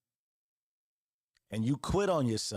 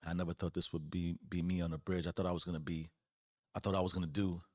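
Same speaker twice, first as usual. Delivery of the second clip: softer, lower voice of a tired coworker; confessing colleague; 5 semitones lower, 5 dB quieter than usual. Retold or imitated. Coworker on an overnight bridge call, soft confession. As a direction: let it slip out like a late-night confession. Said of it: "you start hearing people release their soul".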